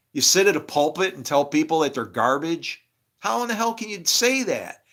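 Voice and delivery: loud, high, indignant rant; angry preaching. Real, not acted. Speaker condemning hypocritical preachers in a loud, high rant. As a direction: rail at the preacher with fire.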